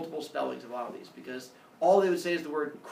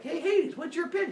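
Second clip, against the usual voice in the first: much higher, chirpy mock pestering; pestering gods; 13 semitones higher than usual. Gods pestering Hades in a high mock voice. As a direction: needle him in a high sing-song.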